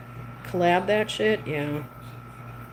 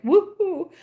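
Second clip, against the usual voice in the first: excited whoop; 12 semitones higher than usual. Retold or imitated. Adrenaline after a big gambling night.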